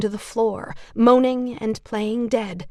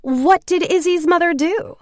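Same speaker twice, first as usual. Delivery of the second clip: high, louder mock innocence; phony concern; 9 semitones higher, 6 dB louder than usual. A narrator's reading. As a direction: ask sweetly while savoring the gossip. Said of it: "Nicole said with phony concern".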